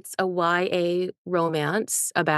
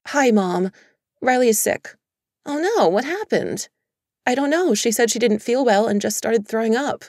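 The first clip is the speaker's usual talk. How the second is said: worried; 5 semitones higher, 6 dB louder than usual. A narrator's reading. Worried call to mom.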